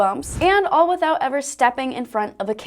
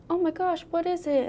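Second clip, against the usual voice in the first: quiet alarmed voice; high, hushed alarm; alarmed friend; 6 semitones higher, 6 dB quieter than usual. Pretend. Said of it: "your friend could be like".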